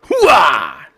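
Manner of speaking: hooah battle cry; loud gung-ho soldier. Acted. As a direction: shout it like a drill sergeant. Shouted intro catchphrase.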